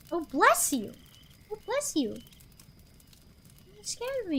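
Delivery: usual speaking voice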